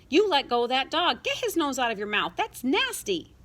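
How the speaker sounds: high, loud, exasperated command; big brother telling off his sister, disgusted; scolding order. A narrator's reading. Brother orders sister to release dog.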